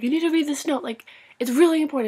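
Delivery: high, loud, hurried plea; urgent insistence. Retold or imitated. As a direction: insist over the crowd that it matters. Pressing Harry to read her note.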